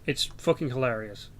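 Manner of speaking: ordinary talk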